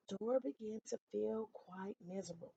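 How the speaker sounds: plain reading voice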